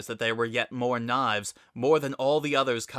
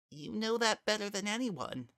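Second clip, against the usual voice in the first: high, hushed and gentle; soft gentle voice; 10 semitones higher, 7 dB quieter than usual. A narrator's reading. Frisk gently tells Kara why Azrael stopped him, soft and high. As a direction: speak softly, as if to calm a hurt friend.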